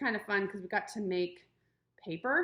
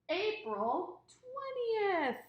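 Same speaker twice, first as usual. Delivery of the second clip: drawn-out announcement; 10 semitones higher, much slower than usual. Acted. Teacher announcing the date.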